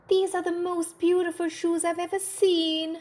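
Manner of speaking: high, gushing lady's voice; a rich lady delighted with the shoes; admiring rich lady. Acted. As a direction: marvel at the shoes.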